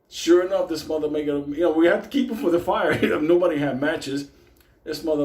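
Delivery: usual speaking voice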